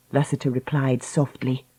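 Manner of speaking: ordinary delivery, nothing marked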